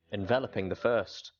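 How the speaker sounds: usual speaking voice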